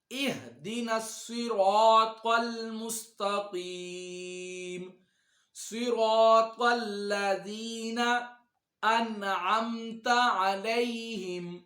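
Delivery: slow, melodic tajweed recitation, drawn out; slow Quranic recitation. Shown as a demonstration. Teacher reciting slowly for students to follow. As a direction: recite each word slowly for students to repeat.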